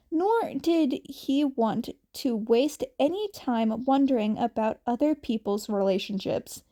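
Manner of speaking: ordinary delivery, nothing marked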